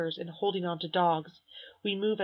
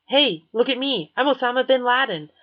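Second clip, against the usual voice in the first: put-on loud, higher taunting boy; brash jeering kid calling out; mocking show-off; 6 semitones higher, 9 dB louder than usual. Ninth grader mocks the crowd, loud and higher.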